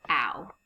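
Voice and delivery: the sound "ow", mouth opening wide; ow vowel sound. Shown as a demonstration. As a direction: say "ow" as in cow, on its own. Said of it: "make a special vowel sound".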